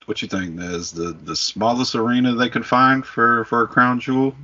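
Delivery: usual speaking voice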